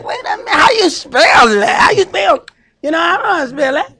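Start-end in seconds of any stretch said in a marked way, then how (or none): 0.0-2.4 loud, puzzled calling out; mock exasperated shout; baffled shout. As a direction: demand the spelling at full volume. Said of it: "You're like".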